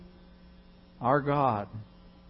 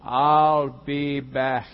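slow, louder deadpan repeat of the line; hammed-up movie catchphrase, louder; Terminator catchphrase; 5 dB louder, much slower than usual. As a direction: say it again, slower, like an action hero.

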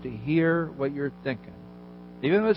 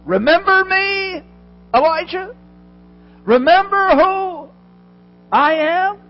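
high, loud and slow, like a voice from heaven; God calling Elijah back to himself, slow and high; 11 dB louder, slower than usual. Acted. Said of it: "he's giving Elijah a reality check".

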